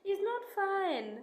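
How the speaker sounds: very high, slow, drawn-out whine; a pleading, tearful complaint; whiny lament. Real, not acted. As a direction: wail it like you're near tears. Distressed about her constant crying.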